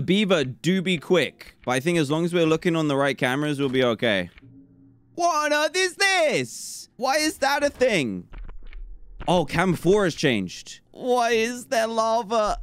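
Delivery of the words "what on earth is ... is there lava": higher, drawn-out bewilderment; stunned high questions; slow high disbelief. Real, not acted. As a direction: ask it slowly, baffled. Bizarre new room appears.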